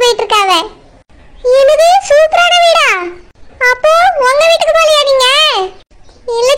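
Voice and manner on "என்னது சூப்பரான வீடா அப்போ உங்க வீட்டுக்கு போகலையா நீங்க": shocked disbelief. Acted. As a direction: gasp at the news and rush the question. Friend shocked by guest house.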